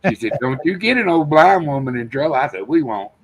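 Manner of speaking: ordinary talk, nothing marked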